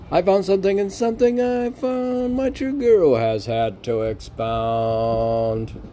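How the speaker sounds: lifted, measured poem reading; poem recital. Acted. Reciting his poem on the podcast, measured verse voice.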